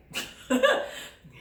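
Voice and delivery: high nervous burst of laughter; a quick freaked-out giggle; startled high laugh. Retold or imitated. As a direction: laugh in shock at your own recording. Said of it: "are freaked".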